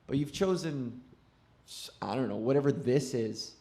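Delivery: low, soft contemptuous sneer; inner heckler. Acted. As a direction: sneer down at her quietly. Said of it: "that voice".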